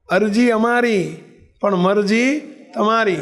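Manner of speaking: slow, weighty line with a pause in the middle; slow solemn maxim. Retold or imitated. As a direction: let each word land like a teaching. Bapa states the maxim. (ધીમે, ગંભીર ઉપદેશ)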